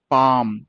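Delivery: "पाम" with a long held "आ"; long aa vowel. Shown as a demonstration. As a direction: stretch the "आ" in "पाम". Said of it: "अगला क्या है?".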